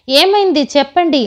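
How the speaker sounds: high, loud demand; shouting. A narrator's reading. Parvati yells at the boys to tell her, a shout. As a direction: cry out for the truth. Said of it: "గట్టిగా అరిచింది".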